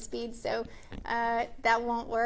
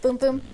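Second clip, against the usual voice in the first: two punchy booms marking the claps; spoken clap beats; 7 dB louder than usual. Shown as a demonstration. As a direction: boom boom, louder, like clapping. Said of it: "where you're supposed to clap".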